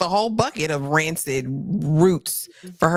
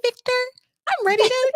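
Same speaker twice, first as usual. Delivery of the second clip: much higher, creepy puppet voice; squeaky ventriloquist dummy; Slappy dummy voice. Retold or imitated. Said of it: "like Sarah's voice".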